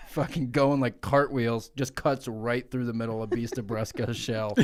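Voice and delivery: ordinary talk, nothing marked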